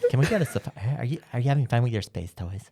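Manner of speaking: put-on high-pitched valley girl. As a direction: play a ditzy valley girl teasing. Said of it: "like, Valley High?".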